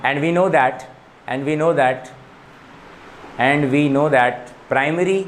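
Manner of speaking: very slow, repeated note dictation. Acted. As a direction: drag each phrase out for writing down. Teacher dictating notes. (धीरे धीरे दोहराकर notes लिखवाना)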